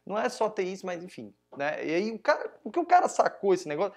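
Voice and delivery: ordinary delivery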